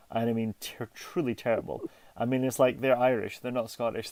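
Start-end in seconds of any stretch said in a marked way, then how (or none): none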